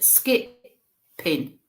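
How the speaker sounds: SKIPPING slowly split into skip, then ping; skipping, syllable by syllable; broken down. Retold or imitated. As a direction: say "skipping" in two drawn-out, separate pieces. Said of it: "she's breaking it down for me to understand".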